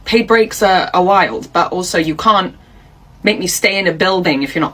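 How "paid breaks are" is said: ordinary talk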